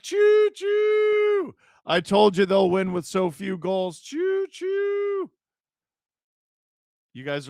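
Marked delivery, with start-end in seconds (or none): 0.0-1.5 high, loud "Choo-choo!" like a toy train; train whistle. Pretend. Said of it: "You know what two two sounds like?". 4.0-5.3 high "Choo-choo!" at normal volume; train whistle. Pretend. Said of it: "You know what two two sounds like?".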